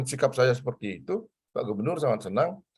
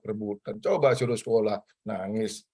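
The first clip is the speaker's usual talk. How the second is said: ordinary delivery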